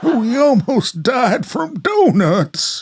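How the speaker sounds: put-on a kid laughing with relief; laughing young Tom. Tom to Grandma after the battle, laughing in relief.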